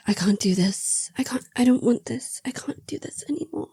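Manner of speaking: high, whispered, breaking-off plea; whispery mock anguish. Retelling her pushing Noah away, high whispered plea. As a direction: whisper it as an overwrought heroine.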